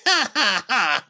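high fake gloating laugh; mock laugh. Podcaster imitating T J gloating, high mock laughter. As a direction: cackle like a smug coworker. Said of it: "And T J's like".

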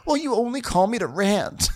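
high, whiny mock retort; mock aggrieved housewife; imitating Kyle. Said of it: "And Kyle's like".

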